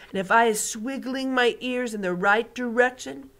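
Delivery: big friendly giant showing off his hearing; booming giant. Acted. BFG voice again.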